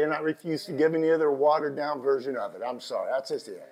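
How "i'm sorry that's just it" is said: usual speaking voice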